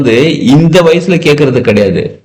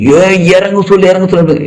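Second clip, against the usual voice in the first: higher, anxious alarmed cry; tense panicked call; 6 semitones higher than usual. Retold or imitated. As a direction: mimic a worried relative panicking over the child. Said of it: "tension ஆயிட்டாங்க". (பதற்றமான உயர்ந்த குரலில் அலறல்)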